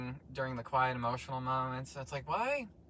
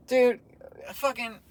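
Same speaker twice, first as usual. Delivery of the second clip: exasperated dude; 9 semitones higher, 7 dB louder than usual. Real, not acted. Exasperated at Spider-Man.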